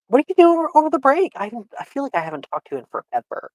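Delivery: bubbly, high and sugary; laying it on thick; flirty high voice. Acted.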